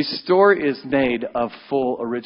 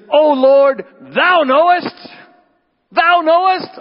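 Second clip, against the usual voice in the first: high, loud solemn King James voice; fervent archaic plea; King James Peter; 12 semitones higher, 6 dB louder, slower than usual. Acted. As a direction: voice Peter in old-Bible style, high and ringing. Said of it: "I don't say thou very often, so I wanted to say it on Easter".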